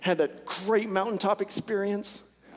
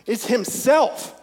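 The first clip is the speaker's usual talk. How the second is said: louder, higher, slow: it's himself; emphatic preaching; 6 semitones higher, 7 dB louder, slower than usual. Real, not acted. Pastor driving home the point to the congregation, emphatic. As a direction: lean hard on himself.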